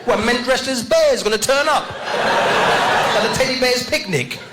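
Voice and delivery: high, sneering street slang; mock London roadman. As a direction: echo the kid back with scorn. Mocking the kid's slang.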